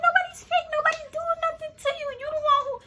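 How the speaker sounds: very high-pitched exasperated protest; retold quarrel, voice shot way up; indignant. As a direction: argue back at the top of your range. Arguing back at roommate.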